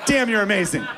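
a giddy fan praising his idol; starstruck gushing. Retold or imitated. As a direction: gush over your hero like a kid. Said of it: "I'm like".

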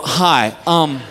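low, halting, painfully awkward request; hammed-up reluctant dad, pitched very low; embarrassed asking. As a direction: drag it out with long, mortified pauses. Embarrassed dad asks store clerk.

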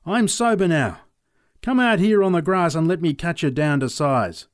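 put-on shouted drunken dare; loud challenge. A narrator's reading. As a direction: call him out across the paddock. Peter shouts challenge to McLeod.

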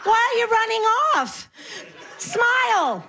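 hammed-up hard-of-hearing granny; put-on deaf old woman, building to high-pitched calling; pretending to be deaf. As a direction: mishear and answer back, voice climbing high. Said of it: "I pretend I'm deaf".

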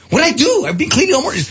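high protesting whine; indignant. Retold or imitated. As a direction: protest your innocence. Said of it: "And I'm like".

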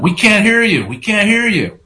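high-pitched heckle, not at full volume; retold crowd shout. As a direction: imitate New Yorkers hollering that they can't hear. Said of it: "started shouting at the president".

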